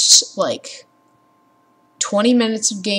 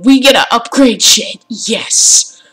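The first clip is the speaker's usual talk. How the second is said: louder, eager cheer; excited cheer; 3 semitones higher, 8 dB louder, slower than usual. Real, not acted. Gamer thrilled about upgrading weapons, loud excited cheer.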